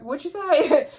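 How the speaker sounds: high-pitched retort through a laugh; mock challenge. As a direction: imitate someone calling out a muttered remark, amused. Retold challenge to muttered remark.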